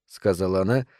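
ordinary delivery, nothing marked

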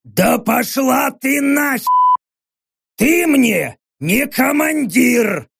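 high, slow growly orc bellow; displeased orc yelling back; disgruntled hoarse roar. A narrator's reading. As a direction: roar it hoarsely, dragging out the words. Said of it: "послышался недовольный, хриплый рёв".

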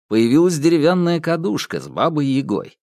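ordinary delivery, nothing marked

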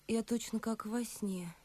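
ordinary talk

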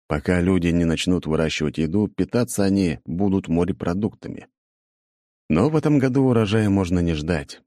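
plain reading voice

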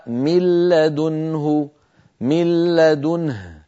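slow Quranic recitation, "من لدنه" with the nun merged into a doubled lam, "mil-ladunhu"; recited with idgham. Shown as a demonstration. Teacher reciting the verse for students, slow with merged nun. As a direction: recite "من لدنه" slowly, nun fully absorbed into a stressed lam. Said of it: "قوله تعالى".

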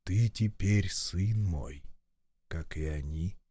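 a father's soft command; gentle merciful voice. Acted. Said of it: "милостивый голос". (мягкий милостивый голос)

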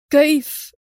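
panicked, strangled disbelief; choked terror. A narrator's reading. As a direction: choke on the word in shock. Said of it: "وهو يقول بصوت مختنق".

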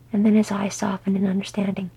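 plain reading voice

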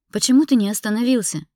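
high, loud, anguished question; tearful. A narrator's reading. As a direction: demand it through tears. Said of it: "уже не скрывая слёз в голосе".

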